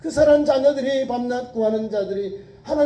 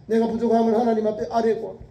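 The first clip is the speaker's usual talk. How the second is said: ordinary talk, nothing marked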